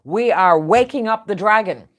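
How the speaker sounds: loud, firm proclamation voicing China; loud defiant warning; defiant declaration. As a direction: announce it like a nation drawing a line. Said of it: "China is finally saying".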